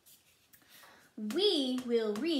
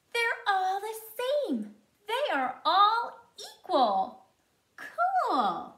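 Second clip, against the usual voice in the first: much higher and louder, delighted; excited; 9 semitones higher, 6 dB louder, slower than usual. Real, not acted. Teacher cheering a discovery.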